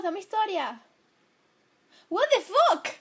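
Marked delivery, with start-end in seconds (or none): 2.1-2.9 loud, high shocked outburst; real shock. Real, not acted. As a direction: blurt it out in disbelief. Shocked by sudden game event.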